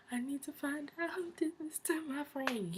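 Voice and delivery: a hint of a grieving vow; voicing a friend. Grieving friend vows revenge.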